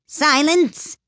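high, sharp royal command; cackled order. A narrator's reading. King interrupting the court, high cackled command. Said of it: "cackled out".

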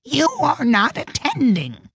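slow, high-pitched scolding Mouse; severe. A narrator's reading. Mouse reproaches inattentive Alice, severe. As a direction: scold severely, drawing out the words. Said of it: "said the Mouse to Alice severely".

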